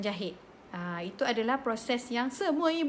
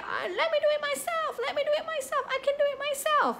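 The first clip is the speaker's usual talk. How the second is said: much higher, frantic mock shriek with a laugh; panicked cry. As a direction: imitate a frightened boy insisting on doing it himself. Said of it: "dia jerit". (jeritan panik budak, nada sangat tinggi)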